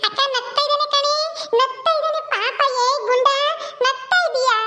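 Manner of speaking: put-on high-pitched delight at a laughing baby; delighted aunt. (ಮಗು ನಕ್ಕಿದ್ದಕ್ಕೆ ಖುಷಿಯ ಎತ್ತರದ ಧ್ವನಿ)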